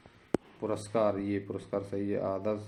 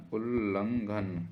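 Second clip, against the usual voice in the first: उल्लंघन drawn out slowly; slow syllable-by-syllable; much slower than usual. Shown as a demonstration. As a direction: sound out उल्लंघन with the doubled ल held. Teacher sounds out the spelling. (उल्लंघन को धीरे-धीरे तोड़कर)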